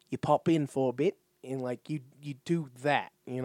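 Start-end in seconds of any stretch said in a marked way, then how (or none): 0.1-1.2 demonstrated New Zealand accent. Shown as a demonstration. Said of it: "New Zealander, you just say".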